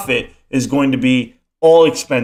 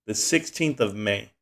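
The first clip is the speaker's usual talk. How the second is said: quiet repeat; 4 semitones lower, 9 dB quieter than usual. Shown as a demonstration.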